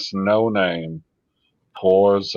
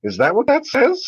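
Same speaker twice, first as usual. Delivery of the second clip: much higher, rising in disbelief; baffled squeak. Real, not acted. A streamer baffled by a confusing chat message.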